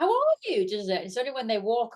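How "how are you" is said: high-pitched friendly enthusiasm; imitated gushing greeting. Fan chats to celebrity as a friend, gushing imitation. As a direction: mimic over-familiar delight, pitched right up.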